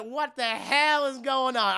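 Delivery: slow, loud, high incredulous question; mock outrage and shock. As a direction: stretch it out in hammed-up disbelief. Voices everyone's shock.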